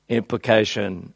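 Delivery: ordinary delivery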